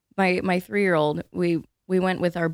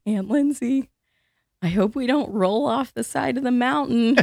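put-on higher child voice, nervous; imitating a scared four-year-old; worried little nephew; 6 semitones higher than usual. Four-year-old nephew in the bus seat, anxious child voice. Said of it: "And he's going".

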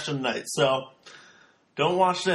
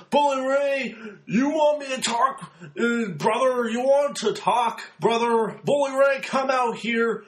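higher, slower, drawn-out wrestling-promo voice stretching every brother; Hulk Hogan impression; 5 semitones higher than usual. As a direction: ham up a rambling Hogan promo, slow and higher. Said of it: "he says".

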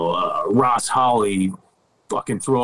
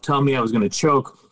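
ordinary talk, nothing marked

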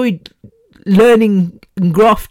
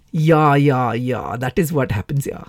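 put-on low, playful Indian accent; jokey Indian-accented aside; 5 semitones lower, 4 dB quieter than usual. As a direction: slip into a mock Indian accent, lower and softer. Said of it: "laughing at my stupid accent".

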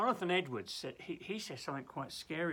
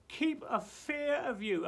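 ordinary talk, nothing marked

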